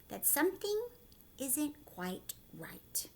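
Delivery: slow hushed whisper; suspense whisper. Acted. Teacher building suspense for the class in a slow whisper. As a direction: draw it out in a whisper.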